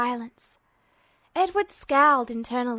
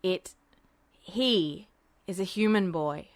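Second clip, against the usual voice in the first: lower, a touch annoyed; mildly annoyed; 5 semitones lower than usual. A narrator's reading. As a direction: colonel correcting the general, mildly annoyed. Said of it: "as though mildly annoyed".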